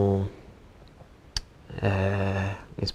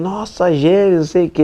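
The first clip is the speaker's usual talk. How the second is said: louder, higher happy cheer; excited cheering; 9 semitones higher, 5 dB louder than usual. Retold or imitated. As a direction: vibrando de alegria com os gêmeos. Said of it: "eu lá do outro lado vibrando". (comemorando animado, mais alto e agudo)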